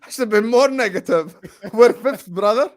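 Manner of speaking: high, loud laughing words; laughing about his pessimism. Real, not acted. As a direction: laugh through it. Laughing at himself.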